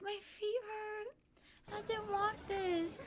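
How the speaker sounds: high, slow, whimpering complaint; mock whiny crying. Friend whines about sore feet, high mock sob. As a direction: imitate a friend whining through tears. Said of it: "she was literally in tears".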